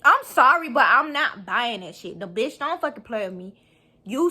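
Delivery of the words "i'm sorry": 1 sarcastic sorry. Real, not acted. Emphatic sarcastic apology.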